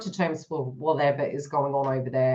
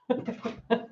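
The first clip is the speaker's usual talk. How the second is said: soft laugh; 4 semitones higher, 7 dB quieter than usual. Real, not acted. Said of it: "I do great until that".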